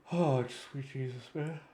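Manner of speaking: groaning through a big yawn; sighing mid-yawn. Real, not acted. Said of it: "I'm sorry if I'm yawning".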